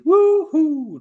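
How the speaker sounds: high, loud whooping cheer; excited woohoo. Acted. Streamer reading a fan's cheer, whooping high.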